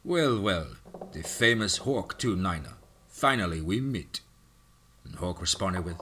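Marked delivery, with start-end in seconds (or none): 0.0-4.2 put-on slow, menacing Russian accent; thick Russian accent. Russian villain greeting his enemy in a Russian accent. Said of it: "spoke in his thick Russian accent".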